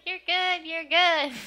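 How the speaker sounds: high, loud "You're good, you're good"; hammed-up loud girlish praise; mock praise. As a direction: gush it like a cheerful girl. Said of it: "So it's like".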